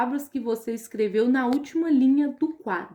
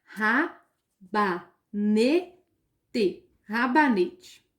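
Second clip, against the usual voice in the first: syllable by syllable; 4 semitones lower than usual. Shown as a demonstration. Teacher splitting rabanete into syllables for the class. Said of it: "ele vem separado em sílabas".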